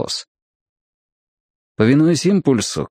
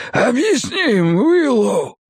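high, slow, strained wheeze; gasping for air; 13 semitones higher, slower than usual. A narrator's reading. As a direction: force the order out with no breath. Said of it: "хватая ртом воздух". (с натугой, задыхаясь)

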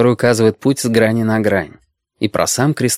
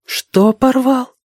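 put-on slow, very high girlish question; baffled girl; 13 semitones higher, much slower than usual. A narrator's reading. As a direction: draw out a puzzled question, pitched way up. Girl character baffled by story. (озадаченная девочка, высокий голос)

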